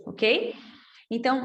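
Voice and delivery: ordinary talk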